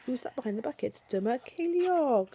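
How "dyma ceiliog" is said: ordinary delivery, nothing marked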